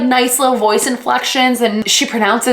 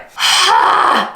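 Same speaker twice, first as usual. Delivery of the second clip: exaggerated sigh; 8 semitones higher than usual. Dramatic sigh after bored.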